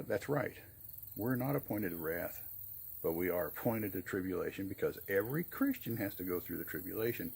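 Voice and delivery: ordinary talk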